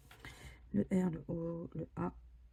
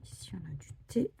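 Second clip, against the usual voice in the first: whisper; 11 dB quieter than usual. Real, not acted. Scratching ticket, murmuring to self.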